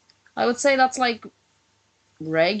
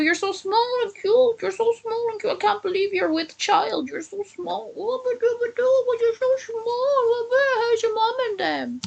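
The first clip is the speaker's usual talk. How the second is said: put-on high cooing baby-talk voice. Cooing at Kennedy about how small she is, in high baby talk. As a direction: coo at her like she's a baby.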